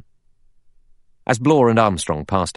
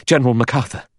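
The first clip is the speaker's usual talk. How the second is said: raised, urgent cry of news; breath coming fast; 6 semitones higher, 3 dB louder, faster than usual. A narrator's reading. Doctor appears at the door with news, breathing fast. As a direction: call it out as if just run. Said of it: "his breath coming fast".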